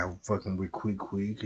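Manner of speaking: ordinary talk, nothing marked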